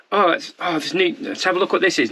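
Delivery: usual speaking voice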